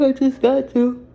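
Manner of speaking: yawning through the words. Real, not acted. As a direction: say it mid-yawn, sleepy. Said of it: "I don't wanna keep yawning".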